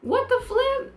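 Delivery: high-pitched, incredulous quote; mock shock. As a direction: imitate someone totally taken aback. Acts out Ty's shocked reaction.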